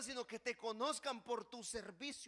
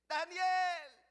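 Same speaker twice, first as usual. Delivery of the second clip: loud, high drawn-out shout; anguished cry; 7 semitones higher, 8 dB louder than usual. Acted. King calling to Daniel at the lions' pit, anguished shout. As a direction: call out desperately into the pit. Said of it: "Con voz triste".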